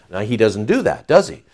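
ordinary delivery

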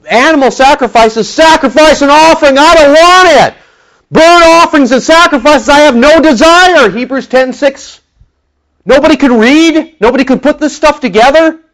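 loud, high, impassioned preaching; fiery preaching. Real, not acted. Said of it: "Calm down, Pastor".

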